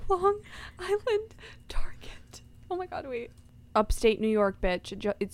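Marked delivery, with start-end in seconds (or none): 0.1-3.3 stunned, breathy whisper; shocked whisper. Real, not acted. Friend hears the star was nearby, stunned whisper. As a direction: whisper it in disbelief. Shocked reaction to celebrity news.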